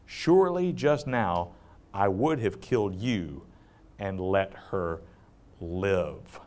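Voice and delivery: hushed, solemn angel rebuking Balaam; angel's voice. Acted. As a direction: speak as a grave heavenly messenger. The angel of the Lord speaks.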